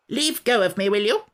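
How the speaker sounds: high, grumpy creature voice; a cross little sand-fairy; Samiad voice. Acted. Samiad character voice.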